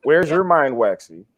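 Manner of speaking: ordinary delivery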